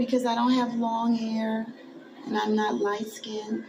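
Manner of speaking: put-on a small girl repeating what hurt her; little girl voice. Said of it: "I said".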